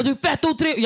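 high, indignant mock yell; imitated yelling. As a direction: mimic the bouncer's shouted accusation. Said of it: "Varför skriker du på mig?".

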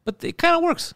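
high voice, grudging; high grudging admission. Retold or imitated. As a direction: admit it squeakily. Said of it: "and I'm like".

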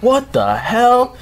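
slow, high-pitched bafflement; drawn-out mock disbelief; retold disbelief. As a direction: stretch it out, incredulous. Retold bafflement at power cut.

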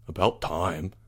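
low, soft grunt; low, offhand grumble; grunted remark. A narrator's reading. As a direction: grunt it grudgingly under the voice. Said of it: "Albert grunted".

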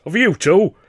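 loud, high, scornful outburst; indignant, blustering contempt; scoffing. A narrator's reading. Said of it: "Weldon scoffed".

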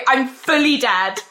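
ordinary talk

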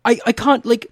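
high-pitched, at a loss for words; aghast, voice pushed high; exasperated. Acted. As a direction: sputter helplessly in acted dismay. Acted despair at the soup.

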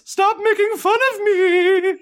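shrieky high, stretched-out ghost whine; wounded silly ghost. Acted. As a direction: plead like a mocked spook. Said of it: "That's your voice?".

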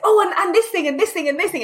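high, eager voice as if spotting new things everywhere; mock excited pointing. Learner in Japan recalling her wonder at everything, excited. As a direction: bounce from one thing to the next, delighted.

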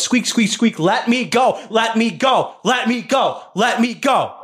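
high pleading rat voice; squeaky rat voice. Acted. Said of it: "and it goes".

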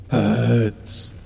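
pained, whimpering word. Acted. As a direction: whimper it through the hurt.

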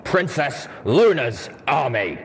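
snapped correction. A narrator's reading. Steel Ranger corrects the zebra, snapping. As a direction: hit each word hard and slowly. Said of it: "snapped the Steel Ranger".

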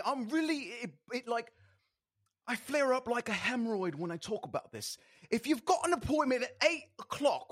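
angry British rant, pitched high and loud; British accent. Acted. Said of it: "do in your famous British accent".

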